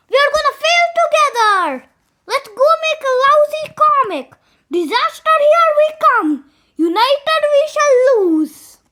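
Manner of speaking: rally the group like a battle cry. Acted. Kids cheer each other on to make a lousy comic, rallying.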